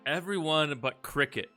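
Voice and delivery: usual speaking voice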